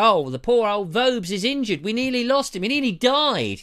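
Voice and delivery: high, loud hammed-up lament; mock weeping. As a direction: wail in mock sympathy, stretching the end. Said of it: "start, you know, weeping up".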